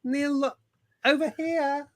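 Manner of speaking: high, eager calling out; mock fan calling. Presenter teasing co-host, calling Jason in a high fan voice. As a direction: tease him with a needy fan voice.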